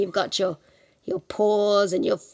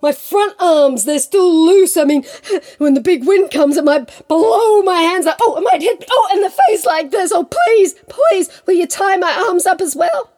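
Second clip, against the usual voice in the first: rising, hammed-up panic; fretful tiger; 7 semitones higher, 8 dB louder than usual. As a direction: work yourself up into begging. Plays Tiger fretting and pleading.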